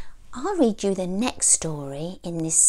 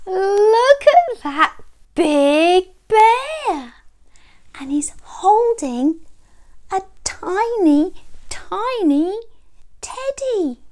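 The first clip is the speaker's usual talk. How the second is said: amazed; 10 semitones higher, 3 dB louder than usual. A narrator's reading. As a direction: marvel high, softening towards the last word. Amazed at bear with tiny teddy.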